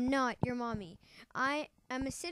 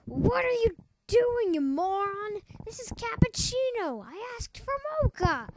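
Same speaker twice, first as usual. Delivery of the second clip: angry; 7 semitones higher than usual. Acted. Boss yells at butler.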